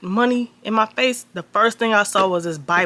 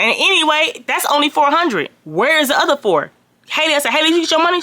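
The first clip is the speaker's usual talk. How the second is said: high, pressing indignant questions; indignant demand; 8 semitones higher than usual. Retold or imitated. Demanding the other four hundred from Tyrell, indignant high voice. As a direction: push hard for the missing money.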